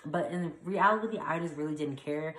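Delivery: usual speaking voice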